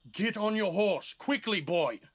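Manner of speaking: commanding shout. Acted. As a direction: bark the order, no time left.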